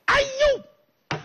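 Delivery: sharp high yelp of recognition; startled cry. Acted. He suddenly recognises his old friend, high startled yelp. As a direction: leap up into a shrill surprised cry.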